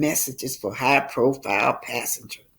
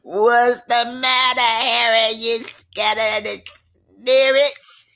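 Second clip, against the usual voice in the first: louder, higher teasing jeer; mocking taunt; 4 semitones higher, 5 dB louder than usual. A narrator's reading. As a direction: rub his fear in his face. Melinda taunting Harry.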